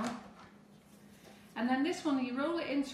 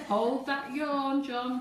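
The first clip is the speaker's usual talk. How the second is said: slow, louder laughing command to hold the yawn; laughing tease; 4 semitones higher, 7 dB louder, much slower than usual. Real, not acted. Host laughingly teasing John about his big yawn. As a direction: tease him through a laugh, dragging it out.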